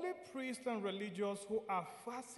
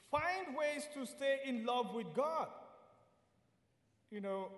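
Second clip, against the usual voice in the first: ordinary talk, nothing marked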